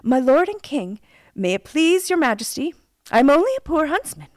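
high, loud, deferential reply; storybook subject politely declining an honour; humble huntsman. A narrator's reading. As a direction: address the king with earnest modesty. Huntsman answers the king.

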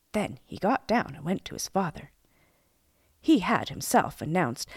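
usual speaking voice

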